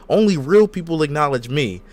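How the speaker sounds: normal talking voice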